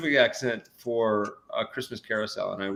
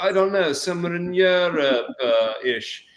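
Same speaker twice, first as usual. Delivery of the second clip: higher-pitched vague royal British reply; mock royal-movie English accent; 6 semitones higher than usual. Actor parodying royal movie characters, mock English accent. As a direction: answer airily in a put-on English accent. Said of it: "everybody speaks as if they're".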